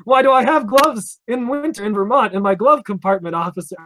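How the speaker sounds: exaggerated sarcastic retort. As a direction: lean hard on glove, mocking the officer's question. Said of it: "I didn't say any of that".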